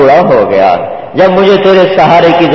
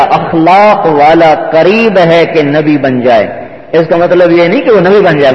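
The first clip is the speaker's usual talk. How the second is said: usual speaking voice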